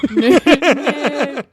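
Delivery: loud, laughing jeer; teasing taunt. Pretend. Teasing her co-host by repeating the kids' jeer loudly. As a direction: throw the taunt back at him.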